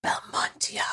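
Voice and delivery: breathy whispered echo of the name. Acted. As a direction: hush it like a secret. Echoing the made-up country name.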